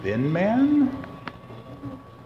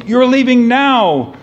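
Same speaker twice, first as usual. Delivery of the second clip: mock scream; 8 semitones higher, 6 dB louder than usual. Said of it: "And she screams".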